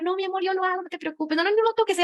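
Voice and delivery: high, sugary "mi amor", insisting gently; sweet reassurance. Retold or imitated. Speaker reassuring her partner after a glass breaks, sweetly high. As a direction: coo at your partner not to touch the glass.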